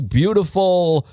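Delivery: normal talking voice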